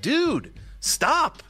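high-pitched mock protest; fed-up cat. Chill cats finally warning the dog, high mock protest. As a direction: squeal it as a cat who has had enough. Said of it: "eventually they're like".

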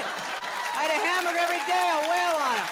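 high, loud meathead bragging; shouted macho bravado; tough-guy boast. Acted. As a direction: puff up like a beefed-up brawler. Boasting he'll beat River up.

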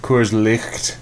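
Coors Licht with a guttural ch; fake old pronunciation. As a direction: say Licht like German licht. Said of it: "It was actually pronounced".

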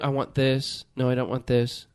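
low, quiet mock customer dithering over choices; indecisive customer. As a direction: mumble like a picky customer who can't decide. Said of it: "everybody's going".

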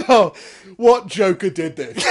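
loud laughing outrage; laughing disbelief. Retold or imitated. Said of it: "voicing the worker's reaction".

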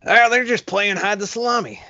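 a hint of a laid-back boat captain; Captain Ron voice. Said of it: "that was actually my Captain Ron".

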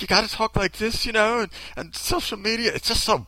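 bad Australian accent. Commentator imitating model decrying social media, shaky Australian accent attempt. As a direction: attempt an earnest Australian and miss. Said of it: "I can't do an Australian accent".

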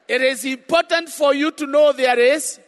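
loud fervent sermon; preaching. Real, not acted. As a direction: preach it with full force. Preaching at full force.

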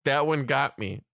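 ordinary talk

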